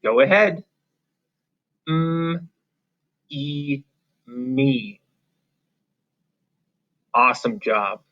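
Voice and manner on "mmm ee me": held hum for "M", soft long ee for "E", then "me"; slowly segment and blend "M, E, me"; sounding out. Shown as a demonstration. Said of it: "will you try the word".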